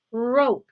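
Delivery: "Rope" loud, with a drawn-out R at the start; "Rope" as a model word, R held; emphasised R sound. Shown as a demonstration. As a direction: lean on the opening R of "Rope". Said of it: "Can you hear the R sound".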